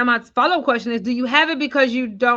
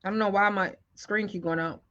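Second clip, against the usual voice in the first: usual speaking voice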